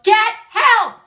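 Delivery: loud, high parting shout; mock yell. Retelling mother leaving a crying daughter, mock yell. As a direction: yell it over your shoulder as you leave. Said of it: "She yelled".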